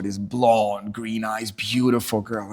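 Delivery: ordinary talk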